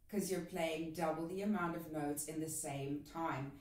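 normal talking voice

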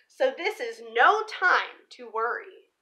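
plain reading voice

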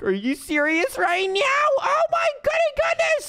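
high, drawn-out squeal; over-the-top excitement. Pretend. Gamer finds a lucky block, thrilled. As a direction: stretch it out in giddy disbelief.

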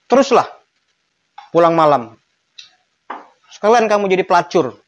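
high, loud scolding mock-command; command meant as prohibition. Shown as a demonstration. Teacher acts out forbidding someone to come home late, sarcastic scolding command. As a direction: forbid it by pretending to order it. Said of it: "dengan intonasi seperti itu menjadi larangan".

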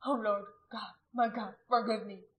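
anguished, penitent plea; weeping prayer. A narrator's reading. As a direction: confess to God through tears. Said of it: "He wept".

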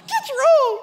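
shrieked mock child's refusal, very high. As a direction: imitate a kid yelling at the top of their voice. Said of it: "he raised his voice a little".

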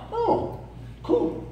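high, quiet casual brush-off; cheerfully sending the kids packing; breezy dismissal. Retold or imitated. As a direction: shrug them off lightly. Quick dismissal.

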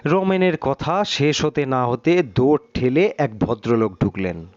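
plain reading voice

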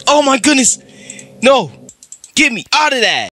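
panicked. Real, not acted. Panicked after jumpscare.

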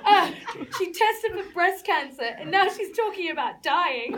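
loud fit of laughter; can't stop laughing. Real, not acted. Actor breaks down laughing.

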